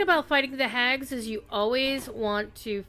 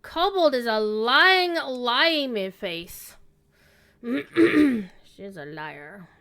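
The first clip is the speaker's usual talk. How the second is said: mock outrage; 6 dB louder, much slower than usual. Mock outrage at kobold joke.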